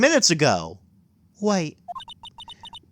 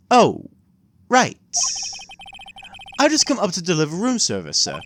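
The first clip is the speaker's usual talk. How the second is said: put-on polite old-fashioned bellhop; lightly voiced formal concierge; concierge bellboy voice; 6 semitones higher than usual. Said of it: "what's a good bellboy voice".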